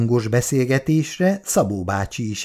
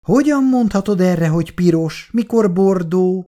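higher-pitched indignant correction; objecting; 7 semitones higher, 3 dB louder than usual. A narrator's reading. Matild disputing the colour of her hat, objecting. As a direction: contradict at once, affronted. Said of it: "Ellenkezett azonnal Matild".

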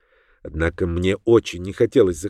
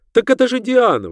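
high-pitched woman's exclamation; sudden high outburst; exclaiming; 12 semitones higher, 4 dB louder than usual. A narrator's reading. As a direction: cry out in startled recognition. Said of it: "воскликнула она".

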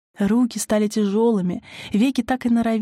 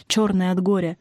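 ordinary delivery

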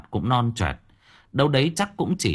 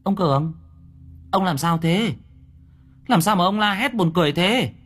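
put-on higher girls' voices asking; higher puzzled girl voice; curious young women; 9 semitones higher than usual. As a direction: tease him for screaming. Girls ask what happened.

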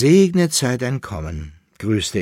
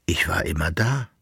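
whispered reply; 3 semitones lower than usual. Acted. Dreaming emperor whispers back to his beloved. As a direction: answer her close and hushed.

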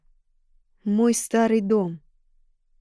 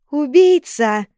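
loud, high cry of accusation; accusing shout; 6 semitones higher, 9 dB louder, much slower than usual. Acted. Mayors accuse her together.

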